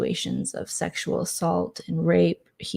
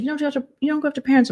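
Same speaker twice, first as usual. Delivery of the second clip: mocking John; 7 semitones higher, much faster than usual. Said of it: "And John's like".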